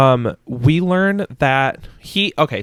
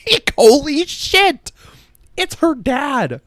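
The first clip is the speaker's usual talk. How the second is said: shocked; 13 semitones higher, slower than usual. Real, not acted. Reliving plot twist shock.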